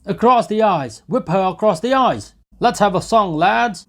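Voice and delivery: loud, high, savage cries; frenzied shout. A narrator's reading. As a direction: roar it at the whippers. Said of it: "shouted Mikolka".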